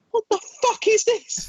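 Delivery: high-pitched horrified squeal; mock-horrified high squawk; shocked outburst. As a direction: recoil in disbelief, voice shooting up. Retelling shocked reaction to film.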